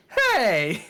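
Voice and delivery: high exclamation in the bard's voice; bard's high "hey". Retold or imitated. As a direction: call out like the offended bard. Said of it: "the bard went".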